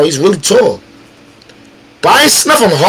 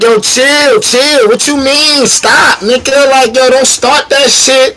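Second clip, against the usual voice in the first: urgent pleading; 6 semitones higher, slower than usual. Retold or imitated. Retelling woman pleading to stop.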